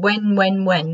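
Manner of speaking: normal talking voice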